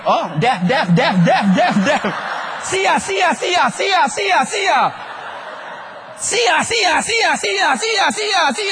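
a hammed-up parent's yell; mock parent yell. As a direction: yell it like a parent chasing kids. Parent shouting at child.

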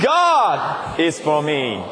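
God punched, the rest slow; stress on God. Shown as a demonstration. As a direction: hit "God" hard, then carry on slowly. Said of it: "emphasizing God".

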